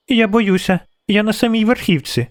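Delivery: put-on high-pitched child's voice; scared little girl. A narrator's reading. Frightened girl at the treetop tells the witcher, high child's voice.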